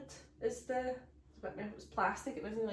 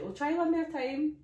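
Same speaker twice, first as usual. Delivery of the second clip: 1 imitated nurse; 8 semitones higher, 7 dB louder than usual. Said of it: "she's like".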